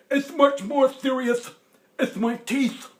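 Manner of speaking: put-on high, loud, slow old man wail; toothless grandpa. Grandpa voiced, distressed.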